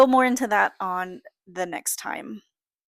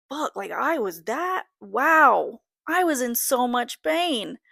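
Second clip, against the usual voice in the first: retold amazement, higher, swooping pitch; stunned realisation; 5 semitones higher, 3 dB louder, slower than usual. Mother recalling her epidural kicking in, stunned realisation. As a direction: relive a wow moment, drawing out the words.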